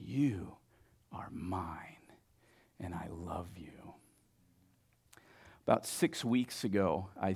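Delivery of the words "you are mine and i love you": low, very soft, with long pauses; almost whisper it, slowly and sincerely; tender hush. Acted. God tells a listener of his love, tender hush.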